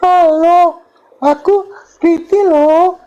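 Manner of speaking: high, slow, breathy camp falsetto; sweet, sassy falsetto with stretched words; camp effeminate voice. Retold or imitated. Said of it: "ngomong kayak bencong". (suara bencong manja melengking)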